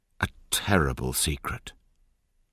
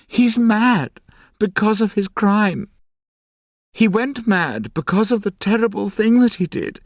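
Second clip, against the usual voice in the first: high, loud desperate pleading, much higher; distressed crying out; 8 dB louder than usual. A narrator's reading. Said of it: "she cried".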